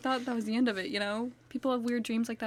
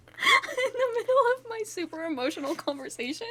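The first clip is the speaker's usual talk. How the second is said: laughing; 10 semitones higher than usual. Real, not acted. Laughing complaint at eater.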